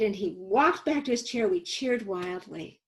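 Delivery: ordinary talk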